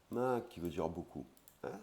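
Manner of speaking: ordinary talk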